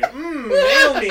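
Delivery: slow, high mock savoring hum; savoring drinker. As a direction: relish it like a connoisseur.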